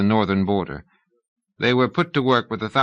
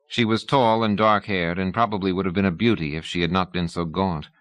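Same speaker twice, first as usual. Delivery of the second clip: ordinary delivery, nothing marked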